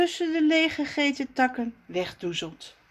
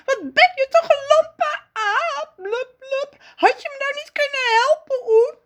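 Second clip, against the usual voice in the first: high, loud crying scolding; tearful tantrum at the clumsy ape; angry, tearful; 5 dB louder than usual. Acted. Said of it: "En dan kwaad". (kwaad en huilerig, hoog en hard)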